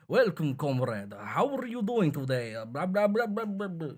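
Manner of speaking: mock Russian accent, rising into nonsense. Shown as a demonstration. Saying anything to show the voice, mock Russian accent. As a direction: put on a silly Russian accent that climbs into babble. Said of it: "you just do a Russian accent".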